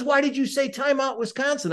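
insist, spelling out the mistake; insistent umpire. Retold or imitated. Umpire pressing the referee, high insistent question. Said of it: "He says".

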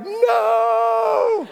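a high, panicked shout; wife screaming no as they launch; scared shriek. Retold or imitated. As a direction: shriek it like the wife mid-air. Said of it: "Casey's like".

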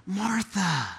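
soft, tender calling of her name; hushed and caring; compassionate. Acted. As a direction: say her name full of empathy. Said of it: "Full of empathy, full of compassion".

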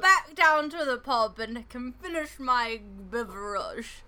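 loud, silly mock British accent; jokey British pub accent, loudly projected; hammed-up British accent. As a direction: carry on in an overblown British accent. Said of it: "You've clearly lost it".

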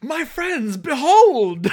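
high, booming showman's reveal; hammed-up triumphant unveiling, last word loud and drawn out; Yavir's grand proclamation. Vedic unveiling the orbs to everyone, grand mock proclamation. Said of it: "Yavir shows up like".

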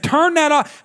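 high-pitched parental command; acted fed-up order; exasperated order. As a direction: re-enact a dad losing patience. Angry father orders son again.

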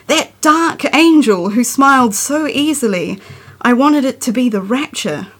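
measured, earnest poem recital; poetry reading. Acted. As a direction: read it like a confession poem. Continues reading her poem.

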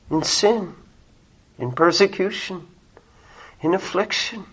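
soft, halting, weighty phrases; hushed preaching. Real, not acted. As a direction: list the sufferings quietly with long pauses. Preacher slows into hushed listing.

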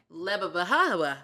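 loud "Lebabahawa" with extra syllables piled in; imitating audiobook pronunciation. Host mimics audiobook reader adding two syllables, exaggerated pronunciation. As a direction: exaggerate "Lebabahawa", stretching it to leh-buh-buh-HA-wuh. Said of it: "how she says it in the Audible".

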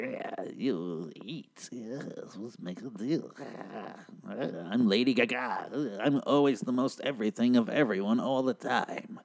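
very quiet, drawn-out mock witch voice. Witch pops out to make a deal, hushed spoof witch voice. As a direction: draw it out softly like an eerie witch. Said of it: "a witch pops out".

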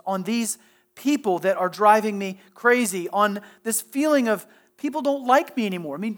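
normal talking voice

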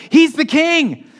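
high, loud, drawn-out exclamation; imitating an excited onlooker; excited realisation. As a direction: blurt it out as the amazed crowd. Room realises, acted out.

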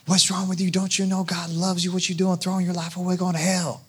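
hammed-up pushy witnessing rant; mock overzealous evangelist, fast and high; intense. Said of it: "that was a little bit intense".

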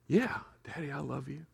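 lightly voiced little daughter, hushed; small child voice. Little girl murmuring her answer to daddy, softly.

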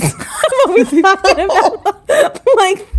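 high-pitched, laughing through the words; cracking up while trying to talk. Real, not acted. Host tripping over yokels for locals, laughing.

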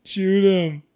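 loud, high mock yell; screaming. Podcast host echoes his buddy's screaming bit, screaming. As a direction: belt out the order. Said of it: "I could see myself screaming".

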